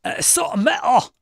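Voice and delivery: loud, high, slow "so metal!" rock yell; mock headbanger cheer; hyped shout. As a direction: belt it like a fan at a metal show.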